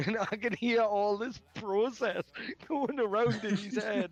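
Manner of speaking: high, laughing delivery; laughing through words. Real, not acted. Storyteller laughing while describing friend's panic. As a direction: talk while cracking up.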